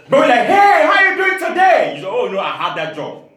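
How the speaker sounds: ordinary delivery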